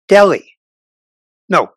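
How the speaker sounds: ordinary talk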